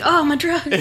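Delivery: high, laughing, delighted; excited. Retold or imitated. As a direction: squeal it through a laugh.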